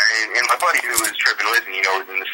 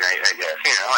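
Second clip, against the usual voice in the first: ordinary talk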